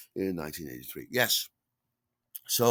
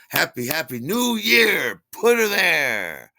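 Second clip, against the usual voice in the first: high, loud, drawn-out holiday cheer; exuberant greeting; 10 semitones higher, 12 dB louder, slower than usual. Retold or imitated. Greeting Steve so he meets his eyes, over-the-top cheer. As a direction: blare it into his face, stretching the last words. Exaggerated greeting to force eye contact.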